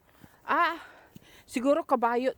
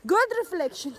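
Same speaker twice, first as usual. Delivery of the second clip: ordinary talk, nothing marked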